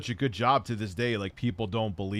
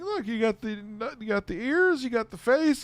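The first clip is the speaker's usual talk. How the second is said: much higher put-on kid voice; high, stilted explaining; son as bad actor; 12 semitones higher than usual. Son pointing out the shirt to dad, high mock voice. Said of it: "He's like".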